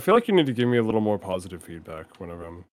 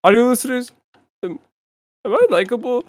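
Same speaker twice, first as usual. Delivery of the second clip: high, loud pleading voice; high needy voice; 12 semitones higher, 4 dB louder than usual. Pretend. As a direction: beg the audience in a high voice. Pleading to audience.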